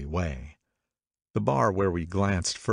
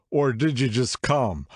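put-on drunk man asking; 5 semitones higher, 3 dB louder than usual. A narrator's reading. Drunk man questions guests.

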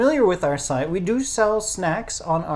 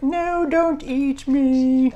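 high, slow tiny pleading voice; squeaky Pikachu voice; 9 semitones higher, 4 dB louder, much slower than usual. Pretend. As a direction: plead like a little cartoon critter. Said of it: "A little Pikachu's like".

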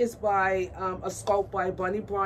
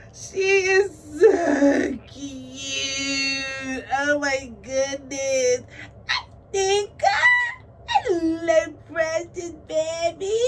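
overwhelmed gushing, words stretched out; high, loud squealing; 5 semitones higher, 4 dB louder, much slower than usual. Real, not acted. Cooing over her precious baby, overcome squealing. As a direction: squeal slowly, nearly crying with delight. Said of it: "my voice going up an octave louder".

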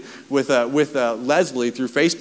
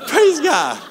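much higher, louder mock "Praise God"; mock exclamation; 14 semitones higher, 4 dB louder than usual. Pastor re-enacting friend's reaction to the sunken ring, high mock cry. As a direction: squeal it to heaven in wry disbelief.

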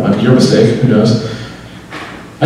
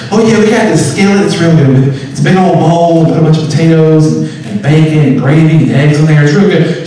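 folksy diner waitress, pitched up; nice waitress; 3 semitones higher than usual. Retold or imitated. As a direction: talk up the specials like a friendly waitress. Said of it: "real nice waitress telling us about the specials".

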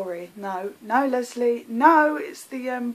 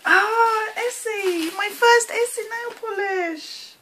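high-pitched squeal of joy; high, cooing excitement; touched delight; 8 semitones higher than usual. Real, not acted. Delighted by first nail polish.

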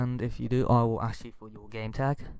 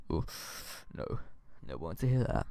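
ordinary delivery

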